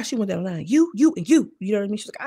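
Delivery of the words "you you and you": mock pointing callout. Pointing down the line.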